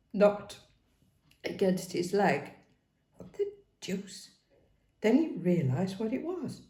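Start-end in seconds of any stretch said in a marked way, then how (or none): 3.2-4.3 breathy whispered surprise; whispered thought. Acted. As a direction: think it in a whisper. Inner thought, startled.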